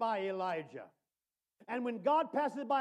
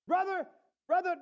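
high, urgent call to a brother; pleading preacher's call; 8 semitones higher, 5 dB louder than usual. Real, not acted. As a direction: call him out with fire. Preacher calls out to congregation.

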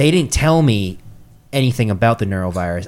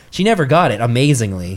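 ordinary delivery

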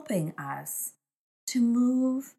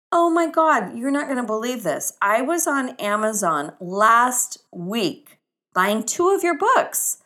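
much higher and louder, gushing in disbelief; amazed exclamation; 5 semitones higher, 8 dB louder than usual. Retold or imitated. Beth gasping to the author on the trail, high excited gush. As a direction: burst out like you can't believe your luck.

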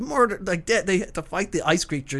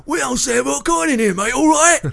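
high, loud hammed-up Yorkshire accent; 10 semitones higher, 7 dB louder than usual. Mocking the bartender's dubbed voice in a Yorkshire accent. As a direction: play a gruff Yorkshire barman. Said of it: "weird Yorkshire style, uh, English accent".

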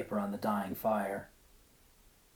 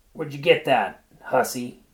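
rough accusing; 3 semitones higher, 10 dB louder than usual. A narrator's reading. Husband accusing his wife.

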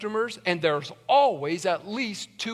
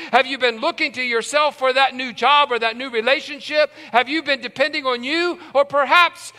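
rising, louder preacher's appeal; preaching at full force; 9 semitones higher, 6 dB louder than usual. Acted. Pastor pressing his church with raised, louder questions. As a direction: drive the questions home to the flock.

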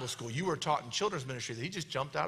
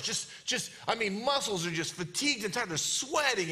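ordinary talk, nothing marked

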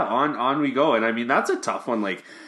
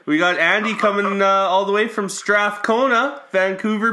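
excited welcome; 10 semitones higher, 5 dB louder than usual. Real, not acted. Hyping the guest's arrival.

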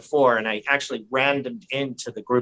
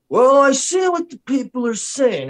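high, slow, mock-sympathetic politician; Jim Desmond; 12 semitones higher, 4 dB louder than usual. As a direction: play the good cop, drawn out. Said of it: "it's like good cop, bad cop. This guy, Jim Desmond, he, he's like".

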